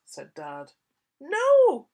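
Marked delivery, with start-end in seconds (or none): 1.2-1.9 high loud shouted no; child's refusal. Acted. Sam refuses to go to bed, shouted. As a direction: yell no at them. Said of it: "said Sam".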